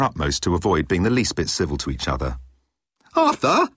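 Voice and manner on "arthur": a high, loud call of "Arthur!"; yelled. A narrator's reading. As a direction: call him out sharply across the room. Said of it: "yelled the elder of the two women".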